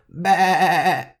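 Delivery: loud, high, wavering goat bleat. Shown as a demonstration. Podcast teacher showing learners what goats say, loud bleating. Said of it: "and they go".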